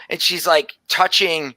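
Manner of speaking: ordinary delivery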